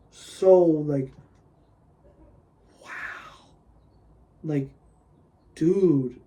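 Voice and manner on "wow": soft, voiceless gasp of amazement; awed whisper. Real, not acted. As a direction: whisper it as if left speechless. Speechless at the animation.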